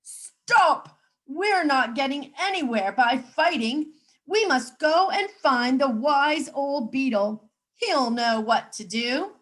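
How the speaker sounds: loud commanding shout. Acted. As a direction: stop the fighting. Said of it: "the chief frog shouted out".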